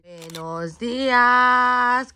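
very slow, loud drawn-out buenos días; sing-song greeting. Acted. Mother greeting her baby daughter, drawn-out singsong good morning. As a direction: stretch every syllable to greet her.

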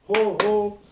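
an overdone loud ho ho; too big ho ho. Shown as a demonstration. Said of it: "ah, c'est trop". (ho ho trop fort)

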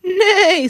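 high, loud whinny; whinny it out like a horse; long high neigh; horse's neigh. A narrator's reading. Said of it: "said the horse".

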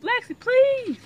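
high loud begging; pleading. Real, not acted. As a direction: beg desperately. Begging for the chicken.

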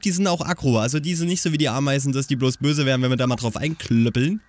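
ordinary talk, nothing marked

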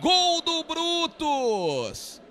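long stretched goal scream; explosive goal commentary. Acted. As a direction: roar the goal call, drawing out gol. Narrator calls a goal.

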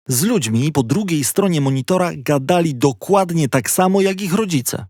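usual speaking voice

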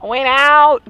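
mock Southern American accent; American accent impression. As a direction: imitate a friend's exaggerated American accent. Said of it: "what Ollie sounds like when he's doing".

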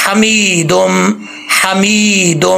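हमीदुम with the ई held one alif long; slow recitation of हमीदुम with a long ई; correct lengthening. Shown as a demonstration. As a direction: draw out the ई in हमीदुम slowly. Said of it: "एक अलिफ की मिकदार जो है वो लंबा किया जाएगा".

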